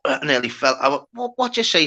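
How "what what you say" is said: shocked. Retold or imitated. Retelling shock at no hands.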